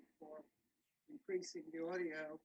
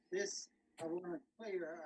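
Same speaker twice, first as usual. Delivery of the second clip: ordinary talk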